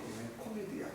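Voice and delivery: a hint of a muttered private remark; inner thought. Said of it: "속으로".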